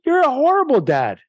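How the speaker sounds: a hint of a child scolding dad; child telling off a father, mildly voiced; mock child scolding. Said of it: "You'd say".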